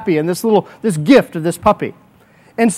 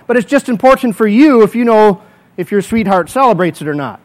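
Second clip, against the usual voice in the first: ordinary talk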